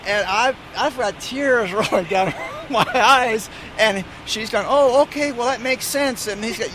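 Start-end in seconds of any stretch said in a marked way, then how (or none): 0.1-3.5 laughing. Real, not acted. Laughs while recalling hysterics. 4.7-6.3 retold satisfied realization; convinced attendant. Retold attendant accepting the tall tale.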